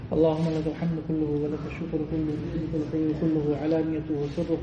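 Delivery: fast, low, hushed recitation of Arabic praise; quick murmured Arabic prayer recitation; rapid Arabic dua. Acted. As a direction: rattle off the memorised dua quietly and quickly.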